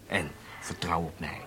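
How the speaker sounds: soft confiding whisper. Acted. Spy whispering assurance to his handlers. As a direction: lean in and whisper it.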